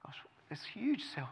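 hushed aside to yourself; whispered thought. Retold or imitated. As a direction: whisper it like a private worry. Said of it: "I think to myself".

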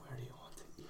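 hushed, voiceless whispered mimicry; mock whisper. Mimicking the whispering co-host's food question, mock whisper. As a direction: imitate someone's furtive whisper. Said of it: "why are you whispering?".

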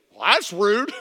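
very loud comic outburst; hammed-up indignant reaction; mock indignation. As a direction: blurt it out, playfully offended. Acts out young self's reaction.

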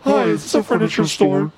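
high put-on mom voice; squeaky pretend mother. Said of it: "here's my mom now".

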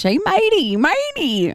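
put-on high, hammy Irish leprechaun accent; bad Irish leprechaun accent. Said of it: "they're Irish".

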